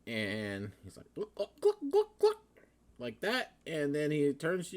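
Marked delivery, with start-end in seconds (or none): 1.1-1.8 high hen clucking; chicken clucking. Acted. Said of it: "like a chicken". 1.9-2.4 squawking hen cluck; higher chicken clucking. Acted. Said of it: "like a chicken".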